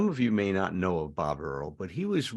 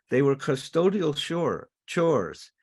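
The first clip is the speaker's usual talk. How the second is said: higher, leaning on "custodial chores"; emphatic repeat; 6 semitones higher, 3 dB louder, slower than usual. Retold or imitated. Speaker repeats the wife's sharp point, stressing "custodial chores". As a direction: hammer home "custodial chores".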